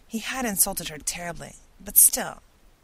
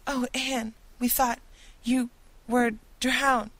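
high, louder, tearful rush of relief; weeping with relief; 7 semitones higher, 3 dB louder than usual. A narrator's reading. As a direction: pour it out high and overwrought. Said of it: "weeping with relief and jo-, delight".

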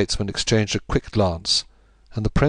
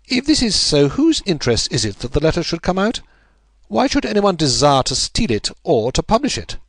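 much higher, sharp questioning; Holmes, puzzled and pressing; 8 semitones higher, 3 dB louder than usual. A narrator's reading. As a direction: chase the motive. Narrator voicing Holmes.

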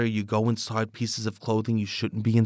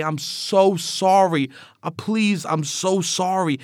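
slow, higher, louder pleading; pleading apology; 7 semitones higher, 5 dB louder, slower than usual. Retold or imitated. Dripping sock apologizing to the other socks, slow pleading. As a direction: beg forgiveness, drawing out each word. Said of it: "and I'd say".

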